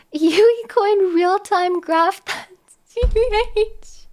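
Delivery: slow, high words squeezed through laughter; cracking up. Real, not acted. Streamer reading chat's joke coin graph, cracking up. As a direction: read it out while losing it laughing.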